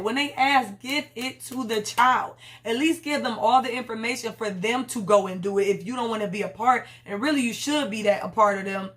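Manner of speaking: normal talking voice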